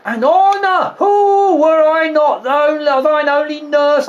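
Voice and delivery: high, drawn-out whoop of approval; gushing, slow and high with pride; delighted exclamation. Acted. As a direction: seize on the word and crow over it. Nurse exclaims at Juliet's answer.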